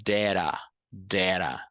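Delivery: "Dera" with T turned into a tapped r; "dera", the t of "that a" as a flap; flapped T demo. Shown as a demonstration. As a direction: show the flap in "Dera", word by word. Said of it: "T juntando com o A vai ter um som de ere".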